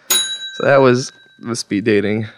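normal talking voice

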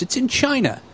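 put-on high, childlike insistence; Ray voice. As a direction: imitate a clueless kid who is sure. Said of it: "And he goes".

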